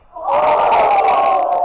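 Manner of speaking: playful monster roar, very loud. Pretend. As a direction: roar it like a pretend monster with the class. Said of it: "Let's say oi in a monster voice".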